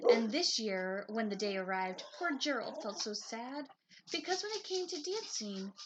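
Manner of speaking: lively storybook narration; reading to children. A narrator's reading. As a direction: read it like a bedtime picture book. Reads picture book aloud.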